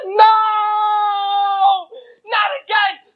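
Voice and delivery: high, loud cry of dread; bride's terrified outcry; panicked cry. Acted. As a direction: shriek it out in horror. Bride hears angel is there.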